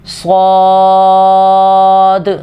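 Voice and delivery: صاد slightly thick, not like س; صاد medium-heavy and long; heavy saad. Shown as a demonstration. Teacher modelling صاد, medium heavy. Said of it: "صاد کو تھوڑا سا موٹا بھی کریں گے".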